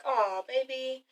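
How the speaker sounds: put-on soft, slow and tender "Oh, baby". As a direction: melt a little as you say it. [name] softly answers Zero.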